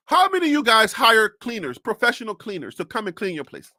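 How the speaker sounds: usual speaking voice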